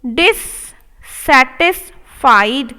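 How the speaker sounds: dissatisfied drawn out, rising at the end; slow model take. Shown as a demonstration. Teacher modelling dissatisfied slowly. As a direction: stretch each part. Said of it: "slow model take".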